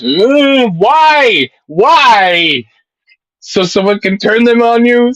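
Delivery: high, loud incredulous why; exasperated outburst. Real, not acted. Arguing against Derek owning guns, high exasperated outburst. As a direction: plead with disbelief.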